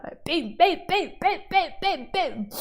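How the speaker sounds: high, loud playful bonks; bim hammer noises. Acted. Teacher mimes whacking goblins, loud playful bims. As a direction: whack each bim like a mallet.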